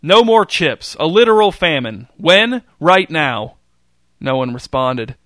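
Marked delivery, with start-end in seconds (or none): 0.0-3.5 furious shout, much higher and louder. Acted. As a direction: bellow it as he flips the table.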